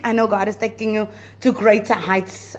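usual speaking voice

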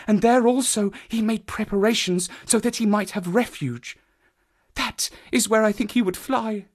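high, subdued female character voice; troubled woman. Acted. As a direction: confide the hiding place earnestly. Voices Mrs Stapleton answering.

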